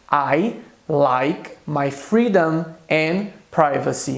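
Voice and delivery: slow, clear repeat of the example; slow repetition. Shown as a demonstration. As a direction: repeat it slowly for learners. (repetição lenta e clara)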